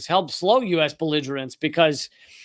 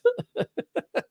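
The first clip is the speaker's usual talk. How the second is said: short high laugh at a viewer's joke; a quick laugh that breaks up high; real laugh; 7 dB quieter than usual. Real, not acted. Streamer to chat, after being teased about his accent: short high laugh.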